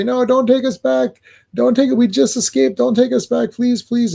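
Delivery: high, pleading whine; hammed-up panicky plea, pitched high; mock begging. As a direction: beg him not to send you back. Prisoners begging the officer.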